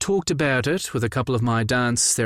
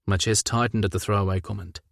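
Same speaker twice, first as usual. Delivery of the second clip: ordinary delivery, nothing marked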